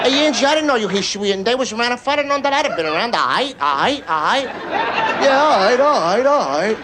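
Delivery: South Philly corner guy, words running together; South Philly accent. Acted. Corner guys explaining they'd have been around, South Philly accent. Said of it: "I'd see the guys in the corner".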